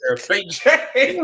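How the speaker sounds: mocking quote. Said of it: "He said".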